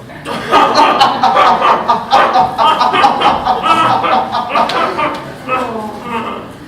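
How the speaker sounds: loud, booming showman's laugh; hearty theatrical laugh. Acted. Host laughs at his own rude joke, booming.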